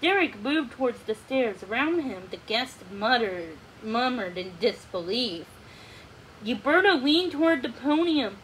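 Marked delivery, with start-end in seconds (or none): none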